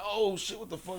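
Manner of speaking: high-pitched yelp of pain and shock; retold pained alarm. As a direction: act out hurting and startled. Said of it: "And I was hurting, too".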